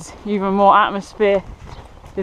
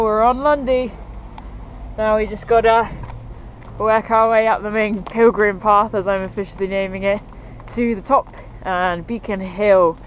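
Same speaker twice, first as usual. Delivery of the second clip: ordinary talk, nothing marked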